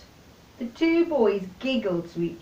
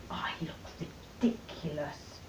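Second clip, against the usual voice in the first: small mortified voice, much softer; embarrassed; 7 semitones lower, 14 dB quieter than usual. A narrator's reading. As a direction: mumble it, embarrassed. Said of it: "said little Miss Muffet".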